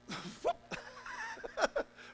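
high burst of laughter. Real, not acted. Preacher laughing at the thought of angry God, high laugh. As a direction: laugh at your own punchline. Laughing at his own point.

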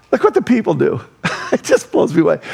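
ordinary delivery

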